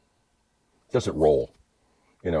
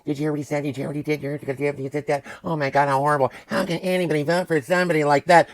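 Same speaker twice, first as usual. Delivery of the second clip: rattled-off mimicry of endless chatter, slightly higher; mock outraged gossips; 4 semitones higher, faster than usual. Mimicking people who repeat the same outrage, mocking chatter. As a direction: parrot their scandalized fussing.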